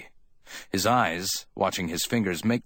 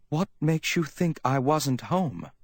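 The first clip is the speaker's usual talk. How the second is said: high, measured woman's question; slow, wary question; 9 semitones higher than usual. A narrator's reading. Woman probing what Sam knows, slowly. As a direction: ask it slowly, pitched up. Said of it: "she asked slowly".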